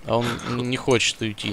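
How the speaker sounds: normal talking voice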